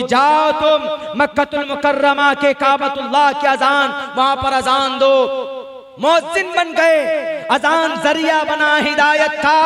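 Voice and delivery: ordinary talk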